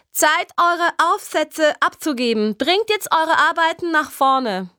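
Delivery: high, slow teacher's announcement to the class; teacher's call. A narrator's reading. Teacher calling the class to hand in essays, high and slow.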